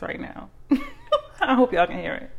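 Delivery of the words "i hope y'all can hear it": laughing through the words. Real, not acted. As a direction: say it with a giggle. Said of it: "hear the smile on my face".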